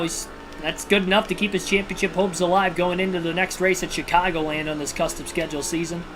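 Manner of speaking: usual speaking voice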